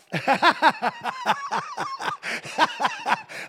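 big squealing laugh; high cackling laugh. Real, not acted. Preacher cracking up at his own joke, high laugh. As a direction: let a high laugh burst out.

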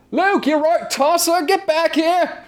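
hammed-up British lad calling his friend back; British friend shouting. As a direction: friend calls Luke back to the tent, mock British yell. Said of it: "friend bent over in exaggerated agony".

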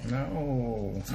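whiny, drawn-out no; nasal whine. Real, not acted. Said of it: "I sound so whiny right now".

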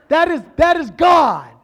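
high, loud shout of recognition; excited shout. Real, not acted. Preacher shouting to his congregation, excited.

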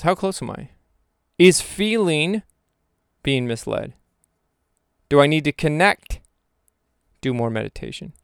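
usual speaking voice

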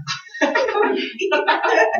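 very high giggle; a squeaky laugh; real laugh at the joke. Real, not acted. Laughs at own skit.

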